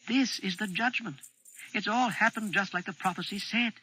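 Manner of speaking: put-on high child voice, hushed with awe; Bane, awed child. Bane tells Limbeck the judgment has come, awed child voice.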